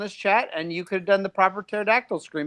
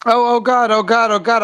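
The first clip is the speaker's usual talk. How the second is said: flustered panic; 4 semitones higher, 9 dB louder than usual. Real, not acted. Caught off guard by comments.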